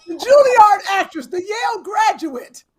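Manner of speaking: loud, high mocking of the trained actress; hammed-up sneer at the Juilliard type; mock posh. Friend mocking the snooty trained actress, loud high mock posh.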